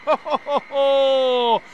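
hearty ho-ho laugh; delighted laugh. Real, not acted. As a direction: burst out laughing with joy. Laughs at the goal.

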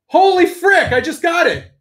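thrilled yelp of surprise; real delight at the shipping news; excited. Real, not acted. Sees figure shipping notice.

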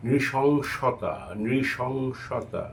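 নৃশংসতা very slowly, each syllable drawn out; very slow pronunciation. Shown as a demonstration. Teacher drilling a hard word: very slow. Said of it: "তেমনি নৃশংস".

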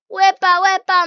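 loud, high celebration; victory whoop. Real, not acted. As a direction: whoop at passing it. Clears the section. (celebración eufórica)

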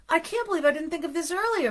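high, eager storybook voice with a hint of panting; mildly panting. A narrator's reading. Mister Bing catching up with Arnie, lightly acted panting. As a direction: read it as a lightly acted out-of-breath realisation. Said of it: "Mister Bing panted".

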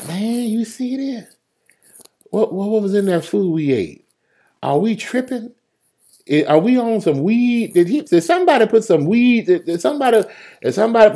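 high-pitched, incredulous questioning; bewildered boatmate. Acted. Man in the boat asks if they are tripping, high and bewildered. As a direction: squeak out disbelief to your mates. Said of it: "if I wasn't [name], I'd be like".

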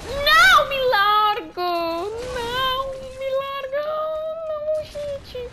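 high, loud cries of despair; desperate pleading. Real, not acted. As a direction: beg it to let go. Grabbed before dying.

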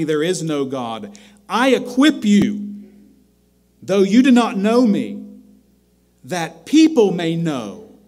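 loud, commanding proclamation; full-force preaching of God's words; booming voice of God. Acted. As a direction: thunder it out as the Lord speaking.

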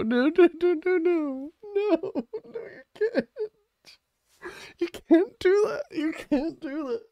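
very high, wailing "no, no"; pleading cry. Real, not acted. Streamer wailing at the game not to take it away, high crying plea. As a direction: beg the game to stop, near tears.

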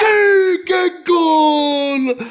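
high, loud cheer over a goal; excited goal cheer. Real, not acted. Father cheers goal in game.

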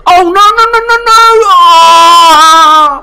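very high, loud string of cries; real high-pitched wail of horror; panicked wail. Real, not acted. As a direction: scream in dismay, stretching the last cry. His monster is dying.

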